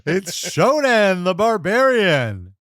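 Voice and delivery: slow, booming announcer call of the name; drawn-out ring announcer introduction. Retold or imitated. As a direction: boom the name out like an arena announcer. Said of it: "Tony Chimmel says".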